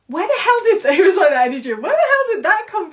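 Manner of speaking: high and loud, laughing in disbelief; astonished outburst. Retold or imitated. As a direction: blurt it out, stunned and thrilled. Retelling a sudden burst of energy.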